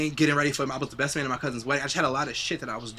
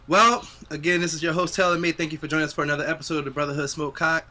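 host sign-off; 3 semitones higher, 6 dB louder than usual. Acted.

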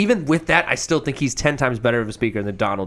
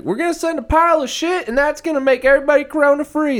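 much higher, boastful mock Trump promise; Trump impression; 12 semitones higher than usual. Mocking Trump's wild promises to supporters, high boastful parody. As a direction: puff it up like a bragging salesman. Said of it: "he could be like".

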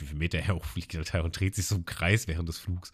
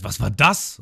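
Lysop shouting; 3 semitones higher, 6 dB louder, slower than usual. Retold or imitated. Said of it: "Und Lysop schreit".

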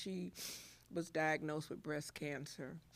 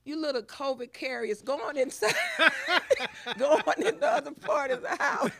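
put-on laughing loud call to the kids; 9 semitones higher, 10 dB louder, faster than usual. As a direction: shout it through laughter. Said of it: "'cause I would call 'em".

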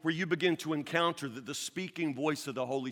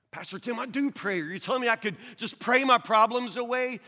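indignant churchgoer; 9 semitones higher, 9 dB louder than usual. Pretend. Objecting congregant voiced.

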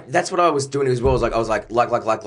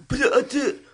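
very high, faltering; choked up. Retold or imitated. Retelling greeting his mates at the funeral, high faltering. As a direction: voice cracking with emotion. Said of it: "I was like".

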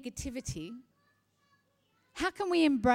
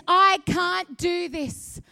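mock despair swelling into shouted no no no; hammed-up negative voice shouting no; negativity building to shouts; 5 semitones higher, 11 dB louder, slower than usual. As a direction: start defeated, build to loud refusals. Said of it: "Negativity says this".

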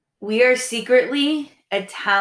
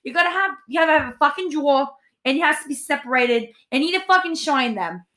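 put-on Queens Italian accent. Mocking a Queens Italian mom to podcast listeners, hammed up. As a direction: ham up a Queens Italian housewife. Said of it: "we talk like this like Italian women".

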